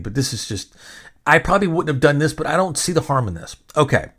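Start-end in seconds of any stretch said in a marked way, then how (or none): none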